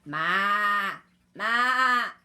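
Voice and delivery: very loud imitated "maa"; goat bleat. A narrator's reading. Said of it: "said the goat".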